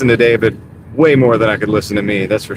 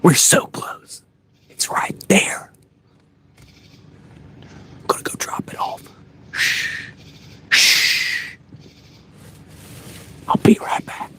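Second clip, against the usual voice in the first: hushed TV-hunter whisper, higher; deer hunter show voice; 7 semitones higher, 6 dB quieter, slower than usual. Pretend. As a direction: whisper like a hunting show host stalking deer. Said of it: "The deer hunting, like, show voice".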